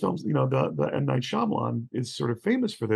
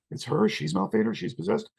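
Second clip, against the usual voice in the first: higher, rising mock disbelief; alarmed questioning; 7 semitones higher than usual. Retelling the characters learning she's possessed, alarmed questioning.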